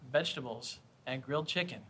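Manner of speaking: quiet, worn-out repeat; tired of answering the same question; weary parent. Retold or imitated.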